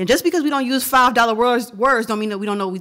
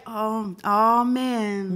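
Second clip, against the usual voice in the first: imagined dismay; much slower than usual. Pretend. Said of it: "I should be like".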